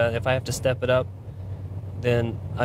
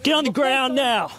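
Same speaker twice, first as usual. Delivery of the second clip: shouting command; 10 semitones higher than usual. Real, not acted. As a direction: yell it to force compliance. Officer orders suspect down.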